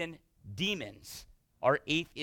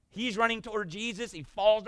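ordinary delivery

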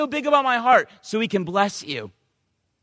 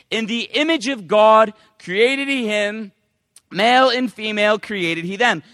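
loud, solemn reading of holy words; loud preacher reading scripture; 5 dB louder than usual. Acted. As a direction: proclaim the verse to the congregation. Reading scripture aloud in sermon.

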